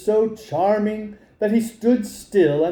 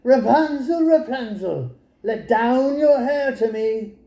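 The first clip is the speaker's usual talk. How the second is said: high call up to the tower, first name rising; 6 semitones higher than usual. Acted. Enchantress calling up to Rapunzel's window, high call. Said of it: "she placed herself beneath it and cried".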